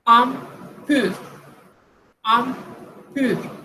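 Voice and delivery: "Impur" in two separated syllables, French rounded u; French pronunciation demo. Shown as a demonstration. Teacher showing the IM sound, French pronunciation demo. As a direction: say "Impur" syllable by syllable with nasal start. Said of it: "I M".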